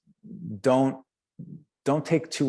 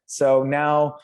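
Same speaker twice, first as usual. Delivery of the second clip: ordinary talk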